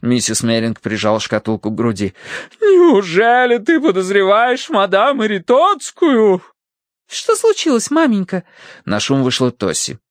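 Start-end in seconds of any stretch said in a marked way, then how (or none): 2.6-6.4 high, slow, loud disbelief; scandalised wife. A narrator's reading. Wife defends the madame to her husband, scandalised. 7.1-8.4 put-on very high-pitched young lady; girlish daughter. A narrator's reading. Daughter comes out to ask mother, high girlish voice.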